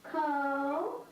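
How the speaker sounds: drawn-out "Ko", held alone for repeating; syllable by syllable. Shown as a demonstration. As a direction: model "Ko" as one long, separate syllable. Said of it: "Let's say it together".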